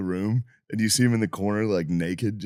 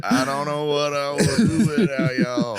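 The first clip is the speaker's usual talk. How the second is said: slow, higher, put-on drunk voice; drunk guy impression; 8 semitones higher, slower than usual. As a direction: slur it like the happy drunk. Friend continues drunk impression.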